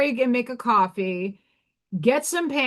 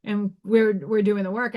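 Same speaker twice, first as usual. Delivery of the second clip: ordinary talk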